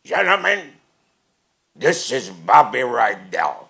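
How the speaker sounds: loud, slow grand announcement, laughing; Podell announcing. Retold or imitated. Club owner presents the singer to his cooks, loud and slow. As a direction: boom it out like a proud boss. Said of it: "he goes like this".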